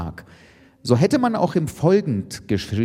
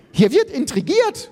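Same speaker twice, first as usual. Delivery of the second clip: high-pitched indignant shriek; screaming; 3 dB louder than usual. A narrator's reading. Iris accusing the others of scheming, screaming. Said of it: "schrie Iris".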